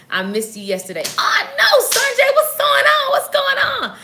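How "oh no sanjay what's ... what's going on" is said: much higher, loud delighted hello; light up greeting a viewer who joined; excited greeting. Real, not acted. Host spots Sanjay in the comments, delighted and high.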